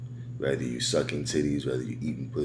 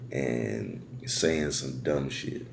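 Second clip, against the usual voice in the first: ordinary talk, nothing marked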